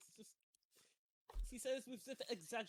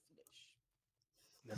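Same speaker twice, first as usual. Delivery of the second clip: whispered creepy voice; 12 semitones lower, 4 dB quieter than usual. Retold or imitated. Imitating the game character.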